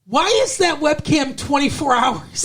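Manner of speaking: high-pitched, baffled disbelief; incredulous. Retold or imitated. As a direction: question it in a high, exasperated voice. Acting out own disbelieving thought.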